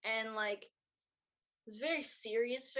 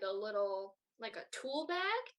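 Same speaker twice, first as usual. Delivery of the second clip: ordinary delivery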